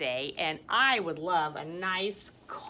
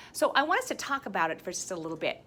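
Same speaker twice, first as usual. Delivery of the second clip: usual speaking voice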